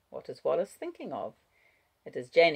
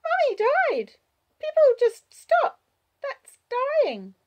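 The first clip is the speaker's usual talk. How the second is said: put-on very high, loud little-girl voice; child explaining; 10 dB louder than usual. A narrator's reading. Child explaining death to Wallace, high child voice. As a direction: state it as a small child explaining.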